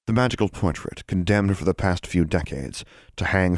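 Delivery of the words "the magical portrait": ordinary delivery, nothing marked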